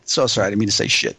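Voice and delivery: ordinary talk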